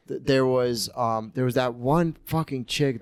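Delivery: ordinary talk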